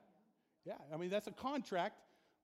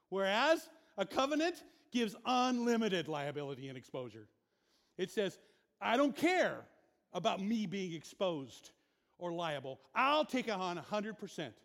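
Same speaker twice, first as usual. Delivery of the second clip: full-force preaching; 7 dB louder than usual. Acted. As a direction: preach it hard, building heat. Preaching loud.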